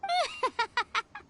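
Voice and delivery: high, short giggle. Real, not acted. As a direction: giggle at the funny story. Laughing at the story.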